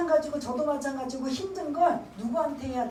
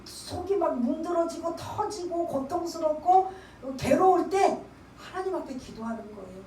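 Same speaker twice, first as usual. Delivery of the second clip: ordinary talk, nothing marked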